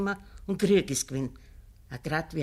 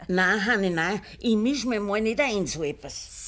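firm refusal; 6 semitones higher, 5 dB louder than usual. Acted. Großmutter weist ab.

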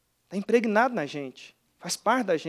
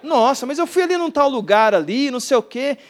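higher, louder imitation of an enthusiastic visitor; gushing mineira woman; 6 semitones higher, 5 dB louder than usual. Pastor imitating a churchgoer raving about the town, gushing. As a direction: chatter excitedly like the woman from Minas. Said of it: "E aí ela falou assim".

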